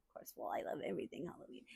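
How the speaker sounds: quick, soft, throwaway whisper; fast whispered aside. Real, not acted. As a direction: rush it under your breath.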